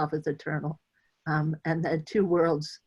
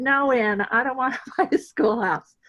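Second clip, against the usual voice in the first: higher, laughing through the no; amused, higher no to a friend's offer; laughing refusal; 7 semitones higher than usual. Retold or imitated.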